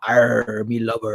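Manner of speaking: Bristolian pirate accent with a growled arr. Shown as a demonstration. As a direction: demonstrate the West Country pirate accent. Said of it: "This is where the pirate accent comes from".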